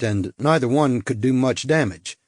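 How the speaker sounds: plain reading voice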